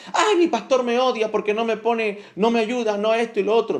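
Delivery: put-on aggrieved churchgoer; mock complaining. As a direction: whine about the pastor, mockingly. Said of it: "señalamos y decimos".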